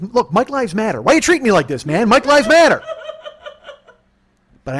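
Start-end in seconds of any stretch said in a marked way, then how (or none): 0.0-2.8 higher, louder mock indignant chant; rally-cry complaint, hammed up; mock protest.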